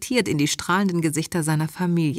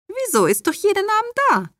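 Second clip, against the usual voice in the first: very high-pitched, incredulous mother voice; puzzled mother figure; 3 dB louder than usual. Acted. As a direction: answer as the baffled mother, far up in pitch. Mother answers in character.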